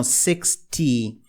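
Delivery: sixty slow and low with a clear T; T kept. Shown as a demonstration. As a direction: slowly keep the T in sixty. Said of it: "aqui o T mantém". (sixty devagar, T mantido)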